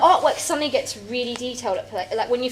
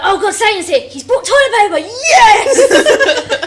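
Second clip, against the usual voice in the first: higher, louder, laughing whoop of joy; mock excited cheer; 9 semitones higher, 10 dB louder, slower than usual. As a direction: yell it like the best news in hell. Demons cheering Satan's toilet paper.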